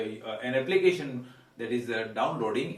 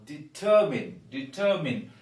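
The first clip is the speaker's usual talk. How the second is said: 'determine' punched on 'TER', ending in 'min'; second-syllable stress; 3 dB louder than usual. Shown as a demonstration. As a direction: say 'determine' as 'di-TER-min'. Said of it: "we stress the second syllable".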